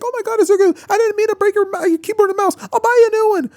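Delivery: frantic apologetic mom, voice way up high; panicked mom. Acted. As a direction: shriek it like a mother who just broke her son's stuff. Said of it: "Her freaking out, she's like".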